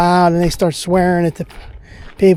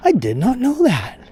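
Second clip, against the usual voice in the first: higher, deliberate, no contraction; imitating Johnny Carson to Ed, higher; Johnny Carson impression; 7 semitones higher than usual. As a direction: do Johnny's deadpan line. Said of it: "and Johnny would go".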